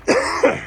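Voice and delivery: sudden sharp coughing; coughing fit. Real, not acted. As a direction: cough hard after swallowing a bug. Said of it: "Swallowed a bug".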